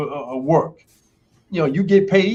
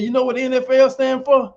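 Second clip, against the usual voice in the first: coach's challenge; 8 semitones higher, 5 dB louder than usual. Retold or imitated.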